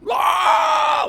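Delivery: loud, very high-pitched "Ah!"; blood-curdling scream. Retold or imitated. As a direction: let out a sudden piercing scream. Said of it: "I hear a blood-curdling scream".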